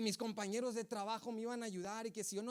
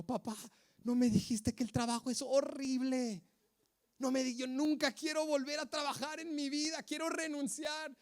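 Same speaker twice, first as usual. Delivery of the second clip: higher, pleading complaint to dad; whiny frustrated teen; 8 semitones higher than usual. Retold or imitated. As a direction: whine like a fed-up fifteen-year-old.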